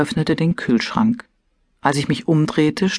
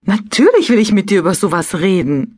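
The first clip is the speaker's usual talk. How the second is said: higher-pitched indignant retort; 5 semitones higher, 4 dB louder than usual. A narrator's reading. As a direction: protest as if affronted. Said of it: "sagte ich empört". (empört)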